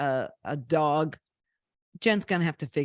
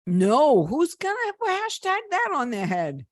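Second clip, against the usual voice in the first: incredulous outburst; 9 semitones higher, 3 dB louder than usual. Real, not acted.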